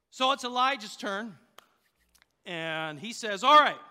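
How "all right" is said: loud, raised call to attention; commanding call. Retold or imitated. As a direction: take charge with a sudden loud burst. Acts Elijah starting his turn.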